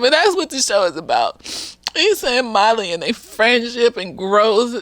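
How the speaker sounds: ordinary talk, nothing marked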